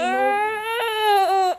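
loud exclaim. Real, not acted. Notices baby's runny nose.

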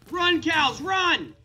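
high, loud drawn-out yell; urgent shout. Pretend. Player urging two cows to escape, urgent shout. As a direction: holler a mock-desperate warning.

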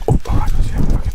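imitating a hushed whisper. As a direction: whisper it like a sneaky cook. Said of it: "the fact that he's whispering".

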